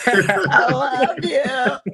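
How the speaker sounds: half-laughing affection for a friend. Real, not acted. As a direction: giggle it out fondly.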